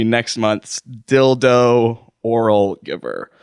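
slow, deliberate restatement of the title; emphatic reiteration. Real, not acted. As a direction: draw out each word to reiterate it. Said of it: "Just to reiterate one more time".

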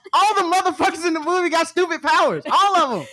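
high-pitched, exasperated argument; indignant rebuttal. Retold or imitated. Telling Keith every superhero has stupid powers, indignant. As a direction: argue it high and insistent.

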